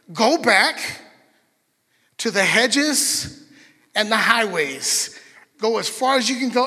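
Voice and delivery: loud, slow "hedges" and "highways"; commanding master. Acted. Master ordering the servant out, loud and emphatic. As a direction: send him out with force.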